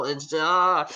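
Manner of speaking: a hint of a frantic mother; flustered mom. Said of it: "It says".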